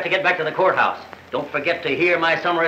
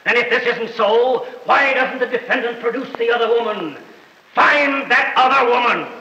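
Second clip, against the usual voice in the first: higher oratory, peaking on the demand; ringing courtroom challenge; 6 semitones higher than usual. Acted. Prosecutor demanding the other woman appear, raised and declamatory. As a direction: hurl the challenge across the courtroom.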